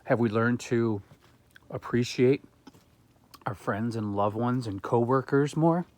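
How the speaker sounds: talking while chewing; words round a mouthful of berry; mouth full. Real, not acted. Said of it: "talk with food in my mouth".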